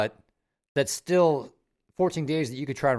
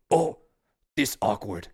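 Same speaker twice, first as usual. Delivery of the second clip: Cookie Monster voice; 3 semitones lower than usual. Retold or imitated. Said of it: "This is a horrible Cookie Monster voice".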